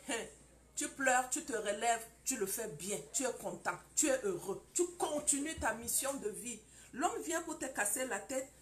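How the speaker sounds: normal talking voice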